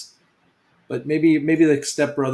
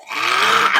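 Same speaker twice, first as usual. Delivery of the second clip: sudden mock scream, louder; imitated scream; 5 dB louder than usual. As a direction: let out an imitation movie scream. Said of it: "he just screams".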